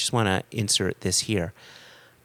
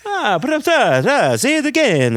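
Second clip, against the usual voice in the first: much higher, louder mock German delight; German villain voice; 7 dB louder than usual. Quoting the film villain asking the name again, in mock German. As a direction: ham it up as the gleeful German officer. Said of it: "who's asking them their name says".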